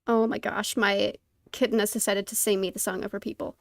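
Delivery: normal talking voice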